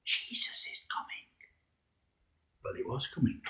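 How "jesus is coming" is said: whispered announcement, no voice; hushed whisper. Acted. Andrew whispering the news to Simon, whispered. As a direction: let it out as an awed whisper.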